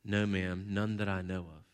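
low, subdued reply; sad admission. Retold or imitated. Rector answering the queen, sad and subdued. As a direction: answer softly and sadly, voice dropped. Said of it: "sadly kinda shook his head and said".

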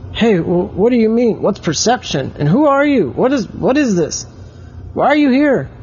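put-on high excited boy's voice firing questions; startled child. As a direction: pitch way up, tumbling questions. Oliver startled by talking statue.

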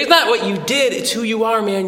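proud dad to son. Retold or imitated. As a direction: gush with pride at your boy. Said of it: "I was like".

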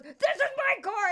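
high, mock-indignant man; hammed-up startled stranger. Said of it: "And he goes".

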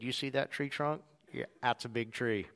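low, hushed worker voice; tree lot worker. Retold or imitated. Worker pointing out a tree trunk to a customer, low and quiet.

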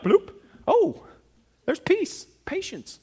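high-pitched mock wonder; pleasantly surprised. Preacher acting out finding fruit while walking, delighted surprise. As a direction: act out a sudden discovery, voice leaping up. Acts out suddenly discovering the fruit.

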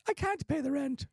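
put-on high, pleading old-time radio voice; radio drama victim. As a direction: squeak out the plea like a hammy actress. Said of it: "They had actors be like".

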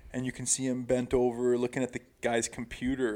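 normal talking voice

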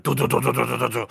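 rattling "du-du-du" imitation; shaking house noise. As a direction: imitate a house shaking with quick repeated syllables. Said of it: "my entire house is just shaking. It's like".

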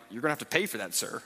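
put-on a bored counter kid brushing him off; snotty attitude. A teen cookie clerk telling the dad to pay, with mild mock attitude. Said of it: "this attitude".

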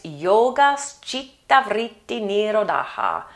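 slow, careful Sanskrit "Yoga chitta vritti nirodha"; Sanskrit recitation. Shown as a demonstration.

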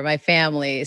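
usual speaking voice

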